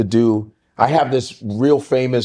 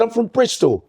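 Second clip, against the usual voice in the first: higher-pitched put-on British accent; 8 semitones higher than usual. Telling how he fooled the British guys, put-on British accent. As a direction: pass yourself off as British, lifted in pitch. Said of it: "I just did a British accent".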